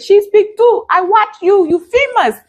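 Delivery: imitated broken English accent. Vlogger imitating the missionary who recognized her, in a high broken English accent. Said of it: "that's my best accent".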